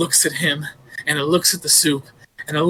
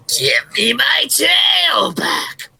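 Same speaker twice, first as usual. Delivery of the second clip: much higher, slow, loud howl; spooky monster shout; 10 semitones higher, 4 dB louder, much slower than usual. Acted. Storyteller voicing the beast in the chimney, slow howl. Creature on the roof.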